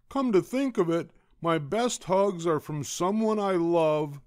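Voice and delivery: high, loud character voice; happy Douglas. A narrator's reading. Douglas snuggling up to mommy, high happy voice. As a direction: exclaim it high with delight.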